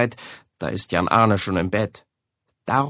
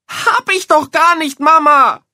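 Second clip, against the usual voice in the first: put-on shouting boy; 9 dB louder than usual. A narrator's reading. Boy yelling at his mother, furious denial. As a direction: scream the denial. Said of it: "schreit Jan Arne".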